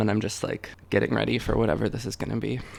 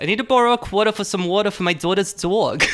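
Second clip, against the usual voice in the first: high, fast, broad New York accent; exaggerated Staten Island accent, pitched up; Staten Island-Brooklyn-Jersey accent; 9 semitones higher, 4 dB louder, faster than usual. Shown as a demonstration. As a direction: rattle it off in thick Brooklyn-Jersey. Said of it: "Jersey combination accent".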